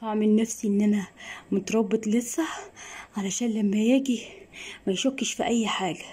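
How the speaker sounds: normal talking voice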